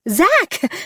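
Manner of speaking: high, playful squeal of his name; giggling squeal. Acted. Giggling as he lifts her.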